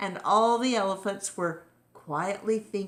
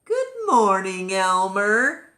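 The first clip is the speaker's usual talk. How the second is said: louder, bright good morning; cheery greeting; 3 semitones higher, 7 dB louder than usual. Acted. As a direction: sing out a hearty hello. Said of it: "each one said to his neighbor".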